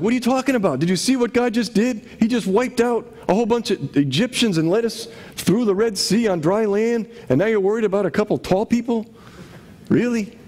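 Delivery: high, incredulous voice of a fed-up Israelite; exasperated Israelite. Retold or imitated. Faithful Israelite scolding the fearful crowd, incredulous. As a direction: scoff in disbelief at the grumblers. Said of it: "a few of these guys are like".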